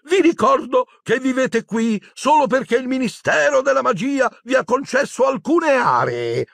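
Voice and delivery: high, haughty official voice; high, nervous bureaucratic warning; prim shrill Umbridge. Acted. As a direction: lecture them from on high. Umbridge scolding centaurs.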